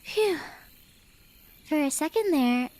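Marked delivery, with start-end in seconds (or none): 0.0-0.4 high relieved sigh. Acted. Relieved the weapon is lowered.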